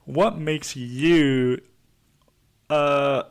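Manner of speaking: very slow, stretched TV therapist voice; Doctor Phil. Acted. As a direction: press them like a talk-show counsellor. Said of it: "my Doctor Phil impression".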